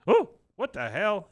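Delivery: loud, high-pitched mock surprise; retold startled outburst. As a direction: act out a jolt of disbelief. Retold startled discovery of a mask.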